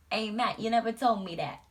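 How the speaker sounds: hammed-up New York accent scold; New York wife impression. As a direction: nag Matt like Vinny's wife. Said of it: "talking to my husband like that".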